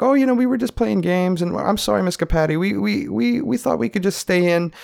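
high-pitched innocent excuse; unfazed friend covering. Retold or imitated. Friend lying to his buddy's mom, high innocent voice. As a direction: pitch it up, politely apologetic. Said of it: "without even batting an eye".

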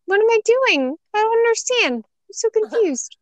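very high, bewildered little voice; high confused voice. Retold or imitated. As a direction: squeak it out, totally lost. Confused kid voice.

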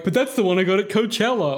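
put-on whiny boyfriend.

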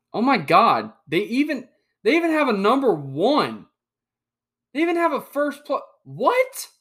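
sudden high, loud outburst of disbelief; "Oh my God", pitched high and loud in real astonishment; shocked disbelief. Real, not acted. Shocked at Texas ranked first.

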